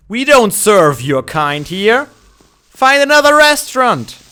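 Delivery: snooty waiter. Pretend. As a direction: turn them away like a snobby maître d'. Shooing a mob from counter.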